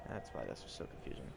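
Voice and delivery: usual speaking voice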